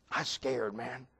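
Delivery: very low, soft admission; hushed confession. Retold or imitated. Sarge admitting fear to the preacher, hushed confession. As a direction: confess it under the voice, deep and small.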